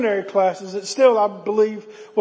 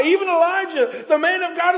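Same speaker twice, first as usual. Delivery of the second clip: usual speaking voice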